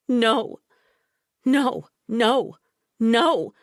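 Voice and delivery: high, loud, repeated nos; desperate crying refusal; sobbing refusal. A narrator's reading. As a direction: cry out against it.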